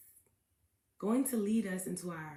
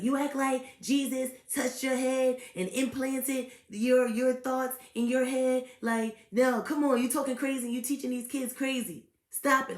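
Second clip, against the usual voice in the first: higher, louder mock rant; imitating angry interviewer; 5 semitones higher, 7 dB louder than usual. Host mimics angry interviewer scolding the guest. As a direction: act out his heated scolding. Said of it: "Like, he was so mad".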